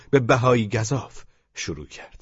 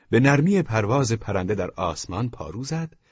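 plain reading voice

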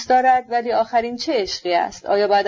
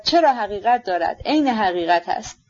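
ordinary delivery, nothing marked

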